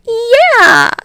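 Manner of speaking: high loud cheer. Acted. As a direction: whoop it. Mina cheering.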